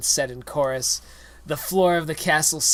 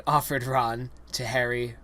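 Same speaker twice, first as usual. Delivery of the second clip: ordinary delivery, nothing marked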